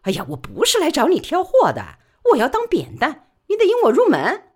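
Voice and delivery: brisk high-pitched working woman pleading her case; Li Baoli's voice. Acted. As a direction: press her case fast and eager. Li Baoli asks He Sao.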